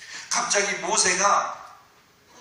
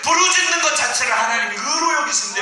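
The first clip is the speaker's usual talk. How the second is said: loud, high shouted sermon; preaching at full force; 7 semitones higher, 10 dB louder than usual. Acted. Pastor urging the congregation to cry out, shouting high. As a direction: thunder it out to the congregation.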